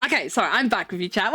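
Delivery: normal talking voice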